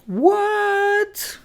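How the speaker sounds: high, drawn-out stunned what; mock disbelief. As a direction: act amazed at the news. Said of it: "And I'm like".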